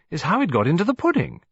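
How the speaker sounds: hint of a puzzled hostess; puzzled old lady. A narrator's reading. Mrs Lacy puzzled.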